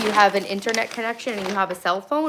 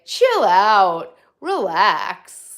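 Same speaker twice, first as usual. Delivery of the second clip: louder, hammed-up boyfriend saying "Chill out"; mock boyfriend; 8 dB louder, much slower than usual. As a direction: mimic the boyfriend's laid-back "Chill out, relax". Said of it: "As my, as my boyfriend would say".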